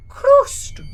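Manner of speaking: high, loud one-word answer; Gru's voice. Acted. Gru pointing outside answering his wife. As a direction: say it flatly as if obvious.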